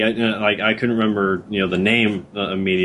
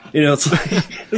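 usual speaking voice

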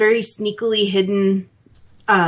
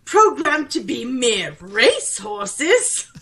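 horrified butler; 3 semitones higher than usual. Retold or imitated. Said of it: "Codsworth is like".

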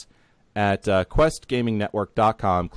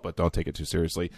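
ordinary talk

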